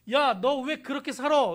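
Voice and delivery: loud, reproachful scolding; harsh scolding. Pretend. Pastor voices the harsh words Jesus never said, loud scolding. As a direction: scold like an angry judge. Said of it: "이렇게 얘기 안 하세요".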